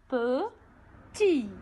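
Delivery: "Petit" split in two, pe, then tit after a pause; syllable by syllable. Shown as a demonstration. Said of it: "Deux syllabes".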